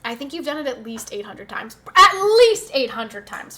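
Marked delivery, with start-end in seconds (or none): 1.9-3.6 loud, high and slow; loud emphatic. Real, not acted. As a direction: belt it out playfully. Playful loud emphatic repeat.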